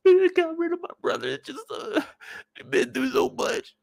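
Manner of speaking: high, broken fake sobbing; hammed-up weepy falsetto; mock crying. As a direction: imitate a tearful woman seeking pity. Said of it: "She get to crying".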